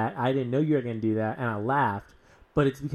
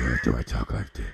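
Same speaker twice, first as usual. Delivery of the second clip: much lower, rough orc voice; half orc voice; 14 semitones lower than usual. Pretend. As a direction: growl it like a clumsy half orc. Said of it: "how do I sound like a half orc".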